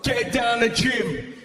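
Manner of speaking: high-pitched fired-up dad rallying for a fight; excited dad. Retold or imitated. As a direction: pump them up like an over-keen coach, drawing words out. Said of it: "My dad was excited".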